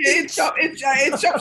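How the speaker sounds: loud laughing repeat of the phrase; laughing mimicry. Woman laughing over the schoolboy's itch-up, loud and amused. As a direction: shout it through laughter.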